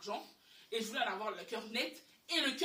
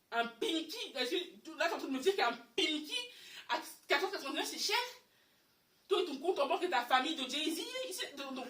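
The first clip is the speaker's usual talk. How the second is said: raised, incredulous mock outrage; indignant disbelief; 5 semitones higher, 5 dB louder than usual. Girlfriend mocking boyfriend's complaint, incredulous and laughing. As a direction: scoff at him, louder and higher, laughing.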